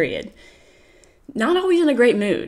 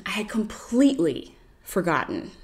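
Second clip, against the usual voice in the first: whisper; 4 dB quieter than usual. Real, not acted. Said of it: "confessing the forgotten plants".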